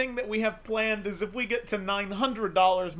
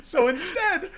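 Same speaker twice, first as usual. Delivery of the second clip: laughing; 8 semitones higher, 5 dB louder than usual. Real, not acted. Giggling while pitching the joke.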